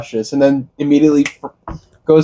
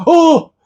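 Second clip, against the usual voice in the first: high squealed imitation of a startled yelp; girlfriend's scream mimicked in a high yelp; mock scream; 4 dB louder than usual. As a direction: squeal it like a jump scare. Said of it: "she'll scream in a movie theater".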